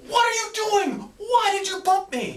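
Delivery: high-pitched mock outrage over a bump; overreacting outburst. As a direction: squeal it out as an overblown complaint about a small bump. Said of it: "bump them on the shoulder going".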